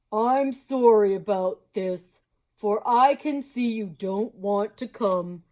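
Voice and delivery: slow, loud, friendly moose. Acted. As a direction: draw it out slowly and loudly. Mister Moose voice.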